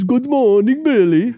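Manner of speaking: high, sing-song imitation of a chirpy greeting; high, bright-toned mock good morning; mock cheery friend. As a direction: chirp it like a clueless happy friend. Friend Johan voiced greeting cheerily.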